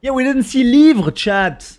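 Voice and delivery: sudden excited outburst; excited shout. Real, not acted. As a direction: blurt it out, delighted. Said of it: "Oh my god".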